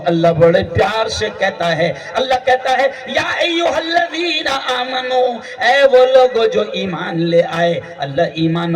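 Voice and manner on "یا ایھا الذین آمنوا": slow, higher drawn-out Arabic; stretched reverent recitation; Quran recitation. Acted. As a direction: intone the verse slowly.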